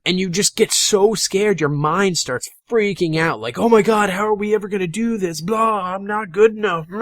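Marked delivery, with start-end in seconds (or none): 3.6-7.0 exaggerated panic. Gamer mocking a scared player's inner voice, hammed-up panic. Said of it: "that was a bit of an exaggeration".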